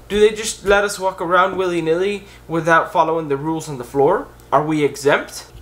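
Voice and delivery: ordinary talk, nothing marked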